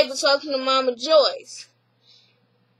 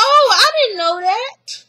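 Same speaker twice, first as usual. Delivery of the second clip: high mock-hurt gasp; feigned surprise; 8 semitones higher, 3 dB louder than usual. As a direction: put on a high, wounded surprise. Mama Joyce voiced, feigned surprise.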